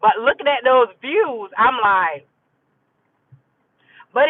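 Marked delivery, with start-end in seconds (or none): none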